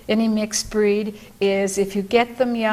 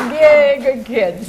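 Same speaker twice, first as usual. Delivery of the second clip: slow, drawn-out happy cheer; praising dogs; 4 semitones higher, 4 dB louder, much slower than usual. Real, not acted. Trainer to her dogs after they come when called, delighted praise. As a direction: cheer on the dogs, stretching the yay.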